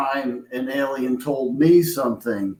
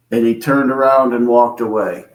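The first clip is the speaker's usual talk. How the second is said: normal talking voice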